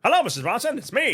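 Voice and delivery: put-on high, clipped old Hollywood voice; transatlantic accent. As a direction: play a glamorous old Hollywood star. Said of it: "This is how he talks in the whole movie".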